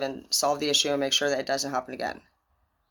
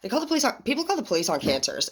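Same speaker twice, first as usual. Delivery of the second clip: indignant; 7 semitones higher than usual. Real, not acted. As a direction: pitch jumps high in disbelief. Indignant retort.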